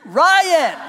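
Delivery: put-on high, loud little-kid call of "Ryan"; toddler calling dad's name. Pastor imitating his toddler daughter, high loud child call. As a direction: shout "Ryan" like a two-year-old demanding attention. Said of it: "tapping on my leg going".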